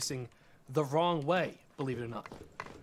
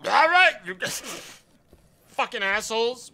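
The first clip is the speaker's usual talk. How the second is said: angry yell; 4 semitones higher, 6 dB louder than usual. Real, not acted. Yelling at unruly customers.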